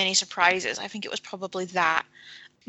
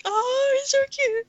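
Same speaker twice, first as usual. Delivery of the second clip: gushing; slower than usual. Retold or imitated. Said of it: "it was like".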